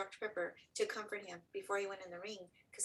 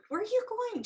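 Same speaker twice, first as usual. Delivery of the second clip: much higher, louder questioning; pitched-up, disbelieving question; retold alarmed question; 14 semitones higher, 9 dB louder than usual. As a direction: retell it as a startled wife.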